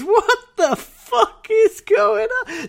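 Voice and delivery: high, slow, laughing panic; mock terror at the storm, half laughing; panicked yell. Friend retelling the storm scene, in a laughing panicked cry.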